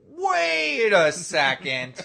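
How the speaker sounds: loud, high, long-drawn-out "wait"; mock incredulous holler. Victim breaks back in on the mic, imitated drawn-out holler. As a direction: stretch it out slowly and loudly in imitation. Retold victim interrupts the prank.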